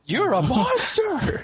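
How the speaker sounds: high, drawn-out mock accusation; mock horrified cry. Everyone remembers what Mewtwo did, mock horrified cry. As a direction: cry it out in hammed-up horror, half laughing.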